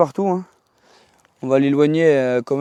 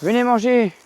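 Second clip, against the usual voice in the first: put-on calling kids to dinner; 8 semitones higher than usual. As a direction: call out to them from afar. Calling foxes to come eat. (appel chantant pour le dîner)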